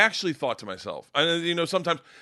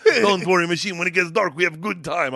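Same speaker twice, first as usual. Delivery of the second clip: put-on higher Russian-accented gangster voice; Igor; 4 semitones higher, 5 dB louder than usual. Gangster promising a good time, Russian accent. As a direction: imitate a Russian gangster reassuring his pal. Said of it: "he's like".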